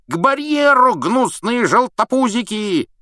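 high, loud, slow knightly challenge; shouting knight. Acted. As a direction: bellow it after them like an old knight. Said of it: "крикнул сэр Кэдаган вдогонку".

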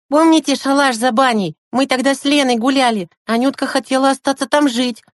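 put-on high child voice, keen; high-pitched kid recalling eagerly; boy Sasha, suddenly remembering. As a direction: pipe up with a sudden idea.

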